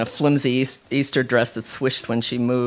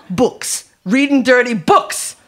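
high, slow, loud disgust; outraged mother; 11 semitones higher, 6 dB louder, slower than usual. A narrator's reading. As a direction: harp on it in outrage. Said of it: "Rita was still outraged".

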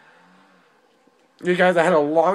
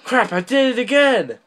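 frustrated outburst; 7 semitones higher, 4 dB louder than usual. Real, not acted. Own mistake in game.

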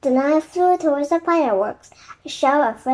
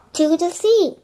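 raised rallying call; higher, projected storybook cry; calling over wind; 5 semitones higher, 3 dB louder than usual. A narrator's reading. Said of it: "Annie called over the wind".

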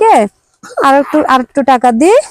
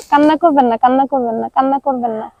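soft comforting; 3 semitones lower, 4 dB quieter than usual. Real, not acted. Comforting a crying old woman.

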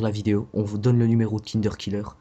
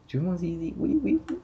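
imitating Dieng; 8 semitones higher than usual. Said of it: "c'est Dieng quand il voit sa meilleure, il dit".

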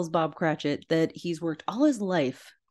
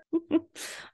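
high giggle; 11 semitones higher, 4 dB quieter than usual. Real, not acted. Laughs after the voice.

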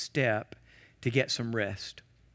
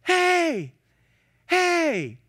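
high, loud call across the beach; yelling; 6 dB louder than usual. Retold or imitated. Young man calling his minister down the beach, loud yell. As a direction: shout to someone far off. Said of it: "down the beach yelling".

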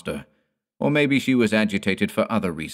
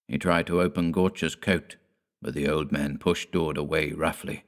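usual speaking voice

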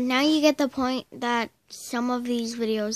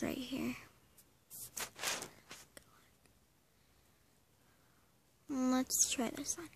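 whispered aside to himself; whispering; 9 dB quieter than usual. Real, not acted. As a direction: whisper it while fiddling with the video. Quiet aside while setting up.

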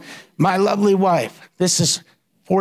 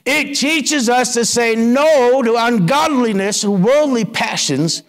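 emphatic scripture; 6 semitones higher than usual. Real, not acted. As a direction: preach the verse with force. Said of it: "that's exactly what this says in verse twelve".

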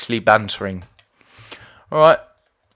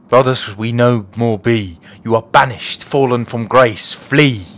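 forceful, declamatory banishment; hammed-up fantasy pronouncement; stern banishing warrior; 2 semitones lower, 5 dB louder than usual. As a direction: cast them out, ending on a loud command. Voices banishing game character.

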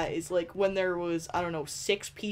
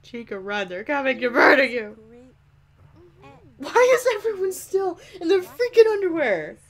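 louder, higher urgent warning; mock alarm; 4 semitones higher, 6 dB louder than usual. Real, not acted. Gamer yelling at her Sims, mock alarm. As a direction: shout a playful warning. Warning Sim, then exasperated.

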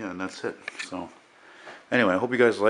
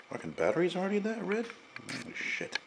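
surprised; 10 semitones higher, 9 dB quieter than usual. Real, not acted. Noticing camera battery low.

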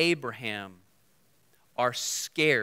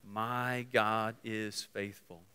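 slow soft declaration; 5 semitones lower, 8 dB quieter, much slower than usual. Shown as a demonstration. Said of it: "you can say".

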